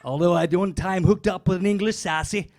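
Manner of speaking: usual speaking voice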